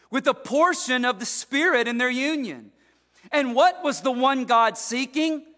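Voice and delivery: loud, rising pulpit delivery; preach. Acted. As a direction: thunder the verse at the congregation. Preaching verse fifteen.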